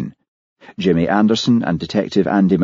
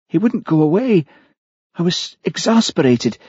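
higher-pitched woman's voice recounting her crime; confessing murderess; 8 semitones higher, 3 dB louder than usual. Acted. Killer confesses to Hamish how she hid the body, higher character voice.

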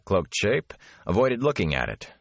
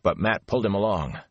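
usual speaking voice

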